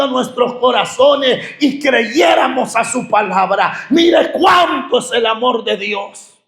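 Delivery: fiery pulpit preaching; full-force preaching. Acted. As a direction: preach it out to the congregation.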